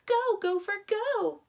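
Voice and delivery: high, loud chant for the gopher; cheering yell. A narrator's reading. Girls cheer for the gopher, loud high chant. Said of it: "Several girls' voices began to yell".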